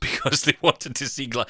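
ordinary talk, nothing marked

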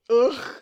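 loud disgusted ugh groan; audible ugh. Retold or imitated. Jenna reacting to seeing John, loud disgusted groan. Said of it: "Jenna lets out an audible".

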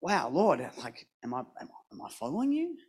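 quiet, stunned self-questioning; asking the Lord in quiet shock; awed hush. Retold or imitated. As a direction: drop to an awed whisper. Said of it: "I was like".

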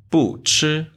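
不 with a high falling fourth tone before 吃. Shown as a demonstration. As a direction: keep 不 falling sharply from high in 不吃. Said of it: "不 stay fourth tone".

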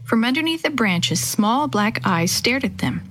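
usual speaking voice

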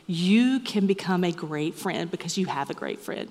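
usual speaking voice

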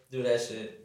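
usual speaking voice

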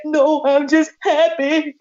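high, slow mock weeping; imitated happy crying. Reassuring Brother Kemet she is happy, mock crying. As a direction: wail it as if through tears. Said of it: "I'm just crying like I'm at somebody's funeral".

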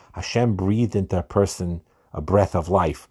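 ordinary delivery

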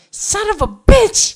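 high, drawn-out loud mock curse; Jorah's outburst. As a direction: curse like a jilted rival, hammed up. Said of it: "And Jorah's, like, going".

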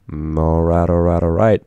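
deep, low, lazy "all right, all right"; McConaughey impression. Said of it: "He's like".

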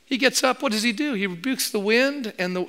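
ordinary talk

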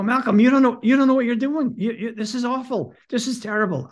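frantic high-pitched objection; panicked protest. Retold or imitated. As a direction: beg him to stop in alarm.

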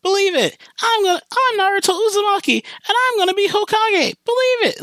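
very high, fast, nasal-free cartoon kid yell; mock an overeager anime hero shouting his dream; Naruto English dub voice. Fan mocking the dub of Naruto, high cartoon imitation. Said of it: "loved the English dub of".

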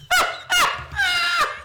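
shrieking burst of laughter; helpless high-pitched cackle; high squealing laugh. Real, not acted. Mocking the Raiders' collapse.

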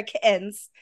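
"kittens" as "ki'ens", T swallowed; glottal stop. Shown as a demonstration. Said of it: "There's a glottal stop".

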